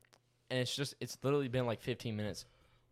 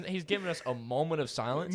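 ordinary talk

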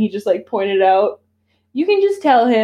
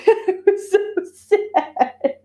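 high, slow words through a laugh; mock-sad line dissolving into laughter; 12 semitones higher, much slower than usual. Real, not acted. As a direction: laugh it out while calling it sad. Laughing at the ripped-up gardens.

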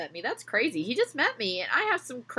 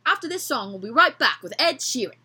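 higher, brighter announcer redo; radio host voice; 4 semitones higher, 3 dB louder than usual. Shown as a demonstration. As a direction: redo it like a DJ on air.

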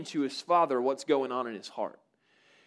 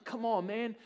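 much higher, earnest begging, slowing at the end; a father pleading with his son to come inside; 7 semitones higher than usual. Retold or imitated. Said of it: "he's begging his son".

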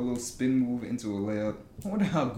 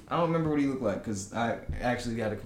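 ordinary talk, nothing marked

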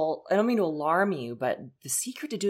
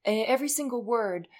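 normal talking voice

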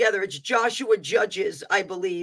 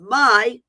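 "my" punched louder and held apart; stress on my; 4 semitones higher, 8 dB louder than usual. Acted. The Lord claiming the power, "my" stressed louder. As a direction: hit "my" hard, then break. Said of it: "that is also capitalized".

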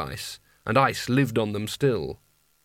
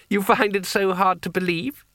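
higher, lightly voiced challenge; amused young master; 7 semitones higher than usual. A narrator's reading. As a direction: ask it in a raised, taunting voice. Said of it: "Coin laughed".

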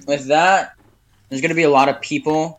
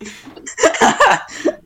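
high squealing laugh. Real, not acted.

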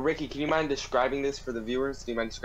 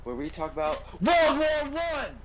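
high, rushed documentary host; TV presenter; 3 semitones higher than usual. Acted. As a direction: announce it like a cable TV host. Parody of a TV presenter.

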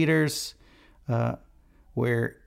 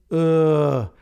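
frustrated groan; 3 semitones higher, 6 dB louder than usual. Real, not acted. Frustrated restart of recording.

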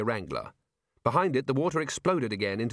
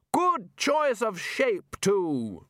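loudly; 9 semitones higher, much slower than usual. Acted. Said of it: "Ridcully continued loudly".

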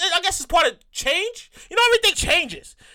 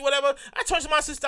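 ordinary talk

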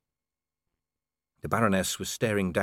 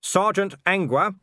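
louder, stress on Sergeant; firmer call; 9 semitones higher, 5 dB louder than usual. A narrator's reading. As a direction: snap her out of it by rank. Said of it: "Now she looked up".

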